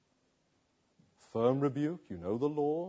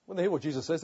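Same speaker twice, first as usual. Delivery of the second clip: ordinary talk, nothing marked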